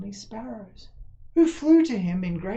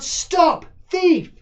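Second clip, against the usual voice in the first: high, loud furious shout of "Stop, thief!"; angry shout; 12 semitones higher, 12 dB louder than usual. Acted. Gardener chasing the rabbit thief, shouting angrily. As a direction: bellow after the thief at full force. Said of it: "calling out".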